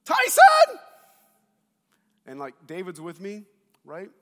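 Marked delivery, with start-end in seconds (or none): 0.0-0.8 very high, very loud shriek of a name; imitated panicked mom yell; mom screaming. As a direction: scream for your son from the tabletop. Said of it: "Mom's up there".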